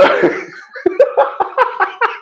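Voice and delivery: high wheezing laugh; helpless laughter. Real, not acted. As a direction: crack up in a high-pitched laugh. Both cracking up at the answer.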